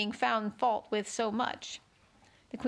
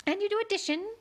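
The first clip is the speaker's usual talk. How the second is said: high, fussy question; 11 semitones higher, faster than usual. A narrator's reading. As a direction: peer at Alice, voice up high.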